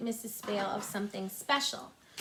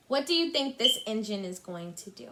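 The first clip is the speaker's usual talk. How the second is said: ordinary delivery, nothing marked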